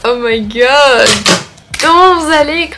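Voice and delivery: normal talking voice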